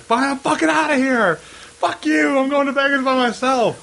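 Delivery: high, strained tantrum voice; hammed-up angry send-off; mock furious. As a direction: storm out in exaggerated outrage. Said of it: "they're so mad".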